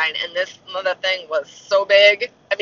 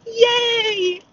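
very high, drawn-out squeal of praise; mock snowflake cheer. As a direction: parody over-sweet cheering for coddled kids. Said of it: "everybody's a snowflake".

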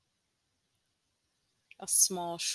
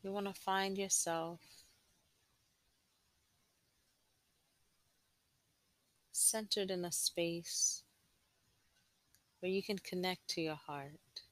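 guided meditation. Acted. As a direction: gently guide them inward, unhurried.

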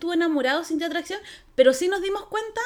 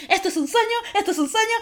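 high, excited mock yelling; insistent fangirl cry, pitched up; retold screaming; 6 semitones higher than usual. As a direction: re-enact screaming at the screen, held back. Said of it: "y yo estaba gritando".

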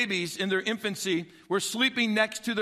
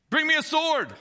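higher, louder commanding call; royal command; 8 semitones higher, 5 dB louder than usual. Retold or imitated. Solomon ordering servants to bring a sword, commanding.

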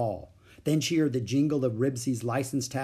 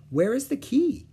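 big sister asking; 13 semitones higher, 5 dB louder than usual. A narrator's reading. Beezus asks.

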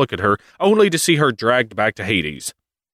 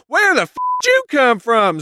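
much higher, mock startled cry; startled Demeter. Demeter spotting Zeus in a hammed-up high startled voice. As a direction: jump at the intruder. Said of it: "Demeter's like".

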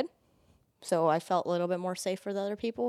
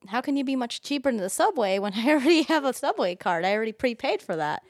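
higher, louder retold protest; mock incredulous; 5 semitones higher, 4 dB louder than usual. Retelling her argument with the ride guy, mock incredulous. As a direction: argue it back, mildly exasperated.

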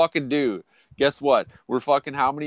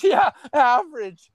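high, drawn-out falsetto scoff; mock disbelieving girl; 12 semitones higher, 6 dB louder than usual. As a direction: mimic her scoffing, slow and squeaky. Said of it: "She goes".

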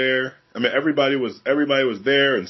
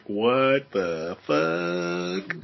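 very slow, stretched-out disbelief; drawn-out bewilderment; 4 semitones lower, 3 dB quieter, much slower than usual. Acted. New Yorker reacting to friendly cops, slow disbelief. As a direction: drag out each word, dumbfounded.

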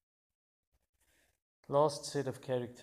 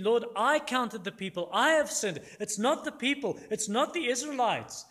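higher, louder pleading as David; impassioned plea; 10 semitones higher, 9 dB louder than usual. Retold or imitated. David pleads with God for the people, impassioned plea. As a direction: cry out earnestly to take the blame.

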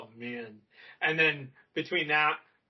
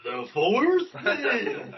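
put-on Mister Sir's accent; 7 semitones higher, 4 dB louder, much slower than usual. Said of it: "I love that accent".

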